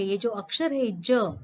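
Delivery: usual speaking voice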